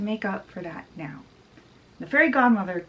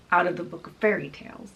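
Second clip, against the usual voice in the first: ordinary delivery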